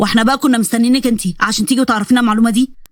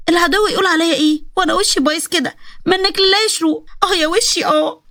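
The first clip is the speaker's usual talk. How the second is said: moaning in a much higher voice; whiny wailing; 5 semitones higher than usual.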